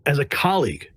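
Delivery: ordinary delivery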